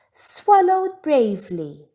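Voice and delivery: ordinary delivery, nothing marked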